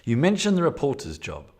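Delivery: loud, dramatic gamebook read; story narration. Acted. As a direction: read the scene aloud like an adventure.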